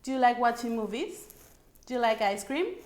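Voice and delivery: "Do you like watching movies" and "Do you like ice cream" each rising at the end; rising question intonation. Shown as a demonstration. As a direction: lift "movies" and "cream" upward as yes-no questions. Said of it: "So we go up".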